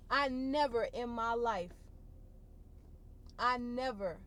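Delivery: ordinary delivery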